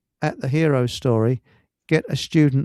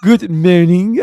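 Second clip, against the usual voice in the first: put-on laughing, louder good morning in a French accent; mock French good morning; 3 semitones higher, 7 dB louder, slower than usual. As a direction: say it like the sitcom Frenchman. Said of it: "could you do a good mooning?".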